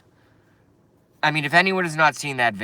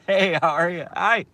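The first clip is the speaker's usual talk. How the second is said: higher, giggly mock greeting; giggling hammed-up hellos in an imitation; mocking giddy laughter; 6 semitones higher than usual. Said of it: "he's like".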